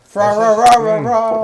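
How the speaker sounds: mock "Fra, ra, ra" Chinese restaurant carol; sing-song mock carol chant; Christmas Story fa-ra-ra. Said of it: "that movie, The Christmas Story".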